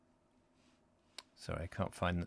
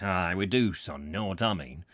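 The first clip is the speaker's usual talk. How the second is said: a grown man's voice, much lower; 5 semitones lower than usual. A narrator's reading. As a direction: drop the voice right down, gruff and Yorkshire. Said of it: "said the utility in a very low voice".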